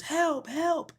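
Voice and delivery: very high pretend distress call; high calling without extra volume; mock cry for help. Trapped man calls for help.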